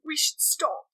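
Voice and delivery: mock-reluctant whisper; imitated breathy whisper. Retelling his protest to her, mock breathy whisper.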